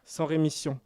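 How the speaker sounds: measured, projected lines with pauses between phrases; slam poetry recitation. Acted. Slam poet thanking companions for the adventure, rhythmic declaiming.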